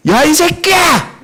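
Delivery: a high, slow, furious yell at the sky; shouting at heaven. Retold or imitated. Preacher retelling how he screamed at God, furious shout. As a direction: hurl it upward in defiance. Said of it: "하늘을 향해 소리쳤어요".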